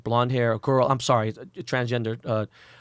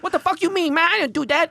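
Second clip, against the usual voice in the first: high, strained voice for Johnny, much higher; mock Johnny protesting in a squeaky pitch; mimicking Johnny; 5 dB louder than usual. Retelling Johnny's denial to Ben, high mock voice. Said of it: "He goes".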